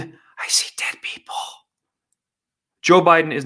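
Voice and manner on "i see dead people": eerie movie whisper; spooky whisper. Retold or imitated. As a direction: breathe it like a scared child. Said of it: "The Sixth Sense".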